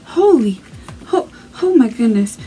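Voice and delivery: breathless stunned gasps. Real, not acted. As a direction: caught off guard, gasping. Stunned reaction to video.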